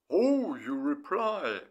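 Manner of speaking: slow, drawn-out happy "Oh, you reply"; pleased message. Retold or imitated. To his girlfriend, glad she replied, slow and pleased. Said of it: "I wrote her".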